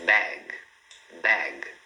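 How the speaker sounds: BAG low, halted on the hard G; stop on G. Shown as a demonstration. Teacher models word for repeating, stopping on G. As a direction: say "bag" as one syllable, nothing after G. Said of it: "Para no G".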